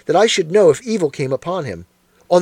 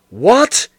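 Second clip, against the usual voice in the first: sudden loud high What, cut short; startled roar; 5 semitones higher, 4 dB louder than usual. A narrator's reading. Holmes jolted by news of a letter, roaring in surprise. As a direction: bark it out in shock. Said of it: "he roared".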